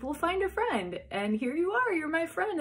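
high, animated quoted chatter; excited retelling. Retold or imitated. Friend excitedly retells, claims her.

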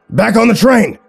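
high, urgent holler; screamed command. Acted. John fleeing the spreading crystals, screamed command. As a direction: order everyone to flee now. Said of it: "John screamed".

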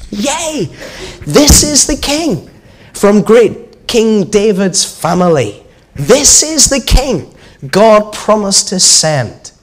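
high, slow, ringing crowd cheer; joyful crowd shout. A narrator's reading. Crowd hailing Jesus as king, shouting. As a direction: proclaim it loudly like a cheering crowd. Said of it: "they shouted".

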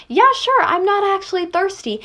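high, put-on imitation; mock agreeable reply. Coaching a classmate's impression, high mock-polite quote. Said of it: "you would say".

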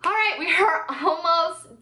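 laughing through the words; a giggle breaking in, pitch up. Real, not acted. Laughs mid-sentence wrapping up haul.

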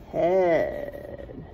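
ordinary delivery

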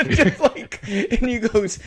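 high, loud laughter breaking through the words; can barely get it out for laughing; real laughter cracking each word; laughing hard. Real, not acted. Recalling a funny scene.

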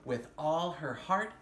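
gentle storybook narration with wonder; story reading to children. Acted. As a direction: read it like a bedtime picture book. Reads picture book aloud.

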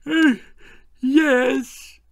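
high, groggy just-woken voice; sleepy Prime Minister. Acted. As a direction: croak it out half asleep. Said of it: "said the Prime Minister sleepily".

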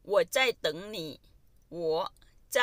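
slow, clear model sentence. Shown as a demonstration. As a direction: say it slowly so students follow. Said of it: "slow model of phrase". (พูดช้าๆ ชัดๆ ให้นักเรียนตาม)

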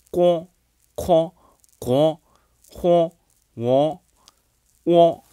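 each example syllable said slowly and separately; dialect readings. Shown as a demonstration. Teacher reads dialect words to the learners, carefully. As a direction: read the dialect sounds out one by one. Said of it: "它这个例子呢有".

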